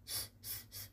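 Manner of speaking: "س" as a bare voiceless hiss; snake-like hiss. Shown as a demonstration. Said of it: "شبیه مار". («س» مثل هیس مار)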